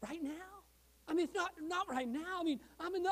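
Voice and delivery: retold sheepish protest, softly stammered; reluctant excuse. Retold reluctant reply to God.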